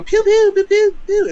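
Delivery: high, loud mock gun sounds; cartoon gun noises; pew pew gun noises. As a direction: shout pew pew like a toy blaster. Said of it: "he's gonna go".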